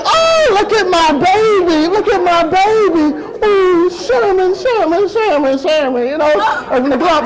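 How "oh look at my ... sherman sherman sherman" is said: high, gushing coos with a tearful edge; excited new mother. Acted. Mother admiring her newborn, acted excitement. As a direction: gush over the baby, pitched up. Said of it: "she's all excited".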